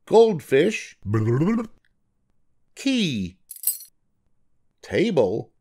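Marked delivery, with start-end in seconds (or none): none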